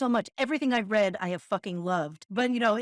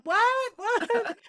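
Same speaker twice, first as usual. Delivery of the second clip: high, loud cupped-ear what; hard-of-hearing what; 14 semitones higher, 4 dB louder than usual. Retold or imitated. To a speaker she can't hear, a high loud what. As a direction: call out what as if half deaf. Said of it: "I'll be like".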